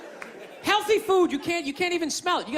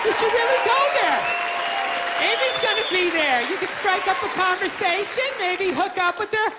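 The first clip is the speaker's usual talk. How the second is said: hammed-up newscaster alarm; news anchor; 6 semitones higher than usual. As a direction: read it like breaking news over the scene. News anchor report imagined.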